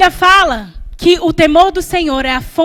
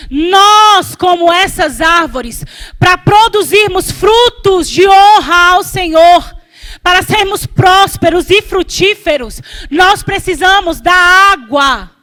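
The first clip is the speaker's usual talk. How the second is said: emphatic, raised sermon voice; impassioned preaching; 2 semitones higher, 4 dB louder than usual. Real, not acted. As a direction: press each phrase home to the congregation. Preaches at full force.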